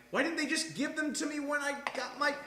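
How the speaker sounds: high, put-on exasperated voice; mock indignant whine; indignant quote. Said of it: "And I'm like".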